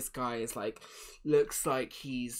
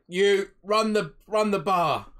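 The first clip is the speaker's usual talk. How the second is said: loud, higher command; innkeeper giving orders; 6 semitones higher, 11 dB louder than usual. Acted. Innkeeper to one of the guys, loud order. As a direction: call out an order to a helper.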